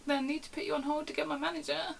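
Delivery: high mock-pleading voice; hammed-up exasperated call handler; exasperated explanation. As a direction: spell it out helplessly to an irate caller. Exasperated handler reasoning with caller.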